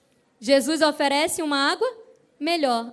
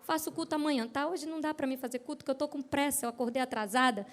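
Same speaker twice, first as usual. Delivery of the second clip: hushed, half-hearted morning excuse; indisposed; 9 dB quieter, faster than usual. Acted. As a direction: beg off quietly, as if not up to it. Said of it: "quando eu acordo indisposta".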